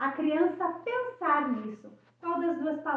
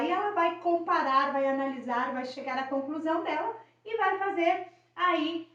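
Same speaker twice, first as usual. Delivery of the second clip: ordinary talk, nothing marked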